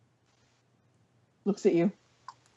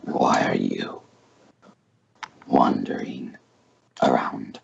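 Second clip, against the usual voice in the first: very deep, rumbling dragon; 3 dB quieter, slower than usual. Acted. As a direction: growl it from deep down. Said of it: "let me get a dragon voice".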